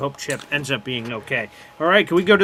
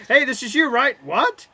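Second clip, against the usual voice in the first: mock hurried handoff; 8 semitones higher, 3 dB louder than usual. Imitating hot potato handoff.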